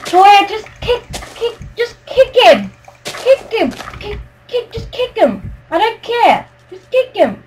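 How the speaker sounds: high, insistent begging; real whiny pleading; pleading nag. Real, not acted. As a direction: keep nagging your friend to do it. Pleading to kick griefer.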